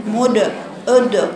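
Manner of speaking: مد، أد with the dal rounded into u; rounded dal, wrong. Shown as a demonstration. Teacher shows the mistake: مد، أد with rounded lips. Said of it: "أد، خطأ".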